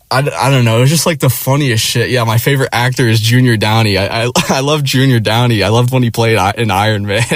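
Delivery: normal talking voice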